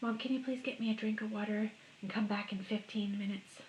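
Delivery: soft, polite pretend plea; hushed, sweet little request; pretend child asking Mom. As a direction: quietly model asking Mom a favour. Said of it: "Ask your mom".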